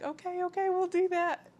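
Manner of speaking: very high-pitched mock compliance; high, loud imitation of anxious agreeing; flustered agreement. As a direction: squeak out a hasty promise to obey. Imitating flustered reply to labouring friend.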